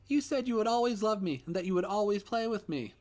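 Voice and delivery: put-on high child's voice, loud and reproachful; a little boy reminding a promise; hurt child. Ghost son reproaching daddy, high and hurt.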